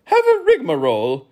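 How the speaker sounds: high, loud count character; count's voice. A narrator's reading. Count offering the bread basket, high and loud. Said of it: "offered the count".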